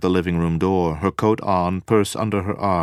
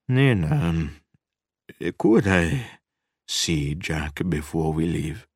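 halting request with long pauses; hesitant; slower than usual. A narrator's reading. Guest asking the mother to see Jack, hesitant. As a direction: feel your way into the question. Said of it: "Kaminsky asked hesitantly".

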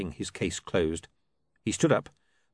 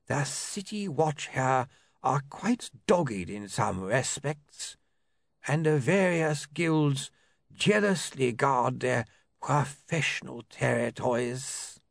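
slow, higher dry lawyer; 6 semitones higher, slower than usual. A narrator's reading. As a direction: weigh every word, lawyer-like.

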